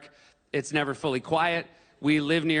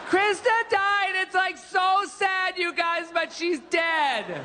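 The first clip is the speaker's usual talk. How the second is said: mock yell out window; much slower than usual. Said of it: "open up the window and be like".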